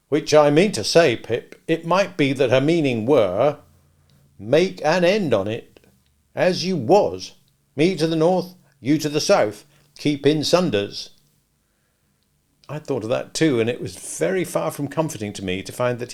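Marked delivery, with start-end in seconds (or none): 0.1-11.1 Joe's voice. A narrator's reading. Joe voiced, quoting Havisham.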